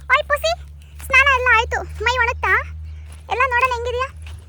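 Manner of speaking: high, loud sing-song talk to a wet cat; high playful voice for the pet cat. Real, not acted. Talking to the cat.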